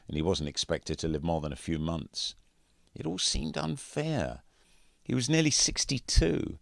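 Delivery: usual speaking voice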